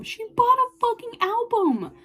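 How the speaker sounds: high-pitched, emphatic disbelief; excited high exclamation; astonished squeal. Real, not acted. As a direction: say it high, scandalized and thrilled. Repeats news in amazement.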